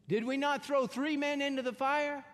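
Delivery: a king who can't believe his eyes; retelling Nebuchadnezzar's shock at the fire; astonished king. Retold or imitated.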